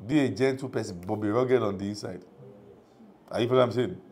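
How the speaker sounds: ordinary delivery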